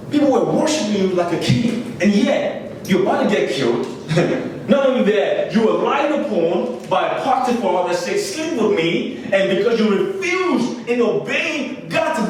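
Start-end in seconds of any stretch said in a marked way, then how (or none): none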